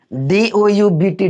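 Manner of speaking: "डी ओ यू बी टी" slowly, letter by letter; spelling it out. Shown as a demonstration. Teacher recalling a childhood reading, spelling "डी ओ यू बी टी" slowly. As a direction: spell "डी ओ यू बी टी" with each letter separate. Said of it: "डाबुट पढ़ते थे".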